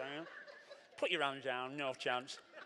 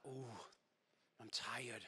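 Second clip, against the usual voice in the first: weary sigh; 7 semitones lower, 15 dB quieter, slower than usual. Acted. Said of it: "we're like".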